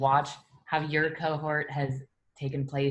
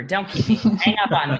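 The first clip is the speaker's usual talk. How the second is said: laughing; 4 semitones higher, 10 dB louder than usual. Real, not acted. Joking goodbye while laughing.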